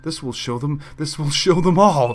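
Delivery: rising to a loud triumphant cry; gloating shout. Acted. Baker gloating over her big plan, building to a shout. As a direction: crow it out like a gleeful villain.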